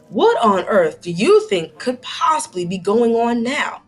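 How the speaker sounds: ordinary delivery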